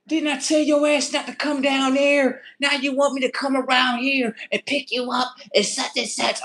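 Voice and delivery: high put-on scolding voice; disrespectful cat owner. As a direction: imitate a man berating his cat. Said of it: "the way how he talking to the cat though is so disrespectful".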